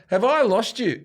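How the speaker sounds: high-pitched rallying question; challenge to the crowd. Retold or imitated. Comic re-enacting his question to the crowd, rallying challenge. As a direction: call it out high to the audience.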